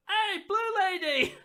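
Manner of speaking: high, drawn-out call; yelling young elf. Acted. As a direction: yell it out loud as a young elf. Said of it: "a young elf yells out".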